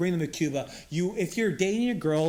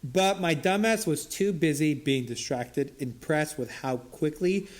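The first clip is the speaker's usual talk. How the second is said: ordinary talk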